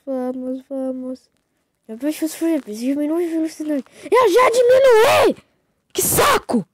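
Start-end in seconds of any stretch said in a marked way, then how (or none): 4.0-6.6 high furious yell; angry shout. Real, not acted. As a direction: snap back in rage. Shouts back at bossy sister.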